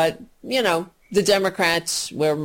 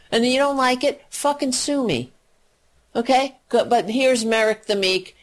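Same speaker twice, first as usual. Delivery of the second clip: normal talking voice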